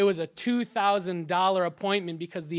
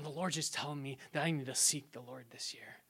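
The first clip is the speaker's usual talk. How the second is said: soft whisper, much softer; hushed retelling; 4 semitones lower, 10 dB quieter than usual. Retold or imitated. Young student sharing his calling, soft whisper. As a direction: whisper it like a confided word.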